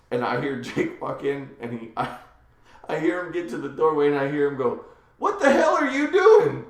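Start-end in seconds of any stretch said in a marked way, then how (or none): 5.2-6.6 high, loud, drawn-out disbelief; imitated outraged shout. Dad walks in on his son, outraged mock shout. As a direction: act out a dad's appalled yell.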